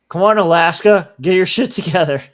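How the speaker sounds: loud exasperated yell at the screen; frustrated shout. Real, not acted. Yelling at a slow website.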